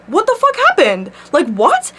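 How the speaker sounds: a sudden high yelp of disbelief; shocked disbelief. Retold or imitated. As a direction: blurt it out stunned. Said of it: "and I'm like".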